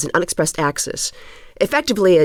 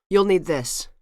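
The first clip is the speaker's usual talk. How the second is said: normal talking voice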